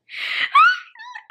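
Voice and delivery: very high, drawn-out whimpering cry; mock crying wail. Storyteller reliving the blocked door, high mock-crying wail. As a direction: let out a hammed-up high sob.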